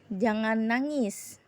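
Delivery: ordinary delivery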